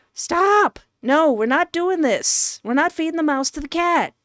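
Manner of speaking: high, urgent refusal; protesting. Pretend. Player refusing to feed the mouse to the cat, high protest. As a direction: cut in high and put your foot down. Player argues back at narrator.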